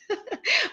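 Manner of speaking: short high chuckle; brief, soft giggle to oneself; laugh. Real, not acted. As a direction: chuckle at your own cheeky remark. Laughs at own lousy bastards remark.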